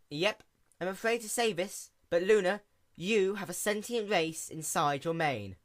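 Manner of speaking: quick, bright-eyed little mechanic pony; lightly voiced sea pony. A narrator's reading.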